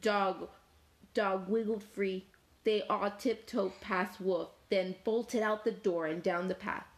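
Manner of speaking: ordinary delivery, nothing marked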